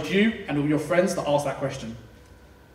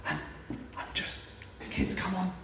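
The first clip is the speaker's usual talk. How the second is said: hushed parent; 2 semitones higher, 10 dB quieter than usual. Retold or imitated. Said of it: "you're like".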